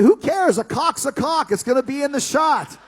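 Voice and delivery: high, loud exasperated protest; exasperated shout. Retold or imitated. Shouting his objection at Vince, loud and high. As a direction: blow up at the absurd plan. Retold heated argument with Vince.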